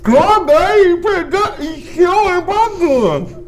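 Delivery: high cracked rambling voice; cracked rambling vagrant. Acted. Voicing character gobbling popcorn.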